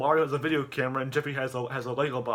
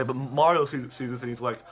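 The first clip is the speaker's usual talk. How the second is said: ordinary delivery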